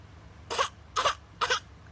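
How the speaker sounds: put-on high breathy baby whimper coughs; little choked sobs before the wail; unvoiced infant pre-cry coughs; baby about to cry. Shown as a demonstration. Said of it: "it's going like".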